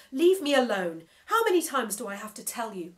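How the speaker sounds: cutting him off. Acted. Boy cutting off the nagging screen mouth, exasperated. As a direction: cut in fast and fed up. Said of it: "I cut him off".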